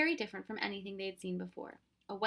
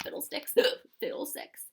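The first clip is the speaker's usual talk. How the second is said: grumbling; 6 semitones higher, 5 dB louder than usual. Acted. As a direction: mutter it in annoyance. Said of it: "the professor grumbled".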